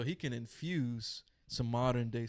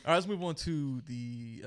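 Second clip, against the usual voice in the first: slow, higher announcer voice; radio host voice; 4 semitones higher, 4 dB louder than usual. Acted. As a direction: stretch it like a radio DJ. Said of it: "turn the switch on a little bit".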